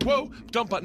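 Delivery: high-pitched retold alarm; alarmed radio host. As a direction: imitate a host jumping for the dump button. Host panics at on-air slip.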